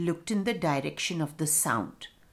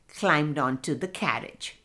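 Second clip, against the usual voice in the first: plain reading voice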